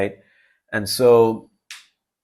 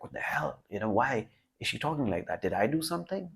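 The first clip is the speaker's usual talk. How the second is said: hushed, half-whispered worried questions; confused inner voice; 7 dB quieter than usual. Retold or imitated. Asking himself why his partner talks like that, hushed and worried. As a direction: ask yourself quietly, unsure what you did.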